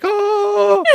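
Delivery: very high laughing mock oh; mock surprise. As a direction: squeak a fake surprise. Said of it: "he's like".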